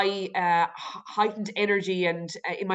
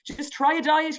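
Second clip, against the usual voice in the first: higher-pitched inner voice, lightly acted; mock urging thought; 7 semitones higher than usual. Guest voicing the diet thoughts that come in, mock urging. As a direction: lightly play a nagging thought.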